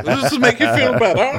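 high, loud cartoonish cooing; mock comforting. Courtney cheering up the injured man, high loud mock comforting. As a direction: put on a silly high comforting voice. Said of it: "She's just like".

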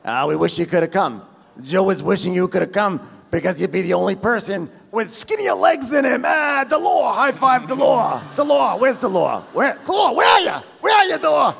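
loud, high ribbing that builds to yelling; tease, then holler for your pal; hammed-up loudmouth, climbing to a shout; Frank. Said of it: "So Frank is like".

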